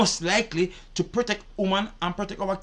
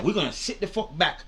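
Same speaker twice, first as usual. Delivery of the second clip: ordinary delivery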